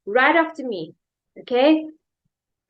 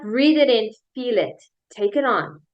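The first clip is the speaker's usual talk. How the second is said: ordinary talk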